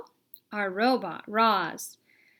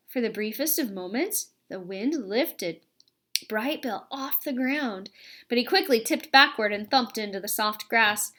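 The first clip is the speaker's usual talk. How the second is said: ordinary delivery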